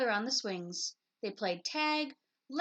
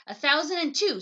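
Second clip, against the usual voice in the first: loud, higher competitive snap; snapped; 5 semitones higher, 10 dB louder than usual. A narrator's reading. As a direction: fire the number back, stung. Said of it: "snapped Sister".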